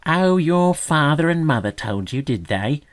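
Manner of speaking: loud, high scornful sneer. A narrator's reading. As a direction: sneer at the naive little bird. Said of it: "sneered the magpie scornfully".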